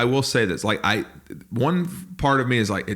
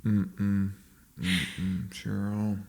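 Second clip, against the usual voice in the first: disapproving dad; 2 semitones lower, 7 dB quieter, much slower than usual. Acted. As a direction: grumble quietly in protest to the wife. Acts the displeased dad to his wife.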